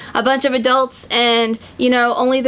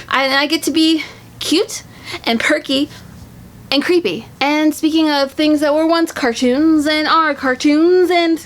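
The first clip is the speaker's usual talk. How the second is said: usual speaking voice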